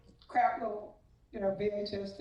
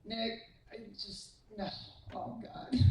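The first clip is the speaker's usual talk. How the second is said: exasperated under breath; 9 dB quieter than usual. Retold or imitated. Rolling my eyes going.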